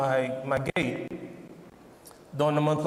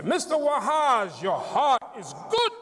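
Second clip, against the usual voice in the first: high, emphatic doctor voice, punching each GOOD; upbeat positive doctor; 7 semitones higher than usual. Retold or imitated. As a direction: impersonate a relentlessly positive doctor delivering results. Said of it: "the most positive doctor in the world".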